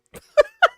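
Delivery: high, loud burst of laughter. Real, not acted. Cracking up at his own coach story, loud high laugh. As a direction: crack up loudly.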